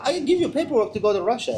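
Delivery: put-on high, Eastern European accented owner; imitated Eastern European accent. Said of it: "when I'm doing the accent".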